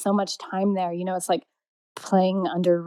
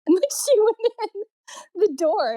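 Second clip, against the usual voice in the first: ordinary delivery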